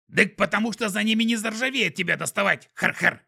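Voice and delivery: high, loud creature voice; snorting skunk voice. A narrator's reading. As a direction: snicker like a snuffling skunk.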